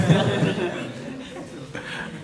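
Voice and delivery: soft airy laugh; quiet amused laugh; breathy laugh. Real, not acted. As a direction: chuckle at the joke. Laughing at own joke.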